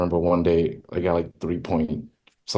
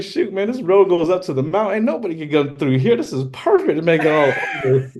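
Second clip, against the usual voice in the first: mock scheming dealer; 10 semitones higher than usual. Joking drug-dealer fantasy.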